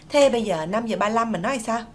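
ordinary talk

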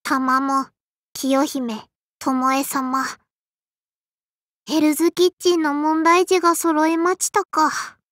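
low, subdued muttered complaint; weary grumble. Acted. Grumbles about the kitchen's problem children.

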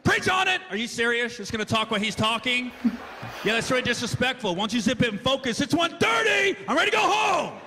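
loud, high, indignant shouting; upset churchgoer. Retold or imitated. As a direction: yell at the noisy pews, fed up.

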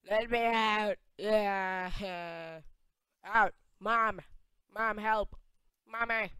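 high, loud wailing for help; mock crying shouts. Locked-in player calling for mom, hammed-up crying yells. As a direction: holler like a trapped child calling a parent. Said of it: "Oh, I'm crying".

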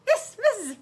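put-on high girl's voice; high-pitched child's confirmation; girl confirming. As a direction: answer high and simply.

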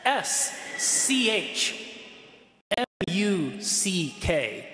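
letter by letter, slowly and deliberately; spelled out. Shown as a demonstration. Priest spells a foreign sign for the congregation, slowly. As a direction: spell it out with drawn-out letter names. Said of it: "I'll spell it for you".

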